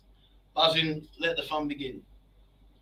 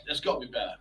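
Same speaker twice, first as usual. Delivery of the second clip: quiet muttered aside; 6 semitones lower, 7 dB quieter, faster than usual. Real, not acted. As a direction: mutter it under your breath. Same words muttered again.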